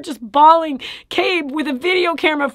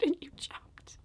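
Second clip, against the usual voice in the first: whispered amazement; 3 semitones higher, 16 dB quieter, slower than usual. Retold or imitated. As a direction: trail into an awed whisper. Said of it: "She goes".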